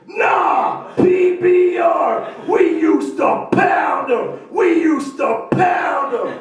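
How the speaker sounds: very slow, high, loud yell; slow party bellow. Acted. Comic bellowing his catchphrase, slow loud yell. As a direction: drag out the catchphrase full volume.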